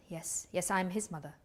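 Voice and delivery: very soft, apprehensive reply; subdued answer. Acted. Mother answering two policemen about her son, subdued. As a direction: answer softly, bracing for news.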